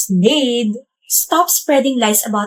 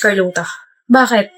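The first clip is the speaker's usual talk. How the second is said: normal talking voice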